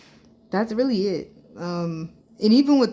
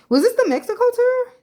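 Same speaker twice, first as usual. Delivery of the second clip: much higher and louder question; startled realization mid-thought; jolted, louder "wait, was it?"; sudden surprise; 7 dB louder than usual. Real, not acted. Suddenly remembering a tour.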